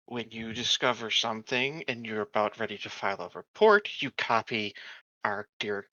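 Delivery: ordinary talk, nothing marked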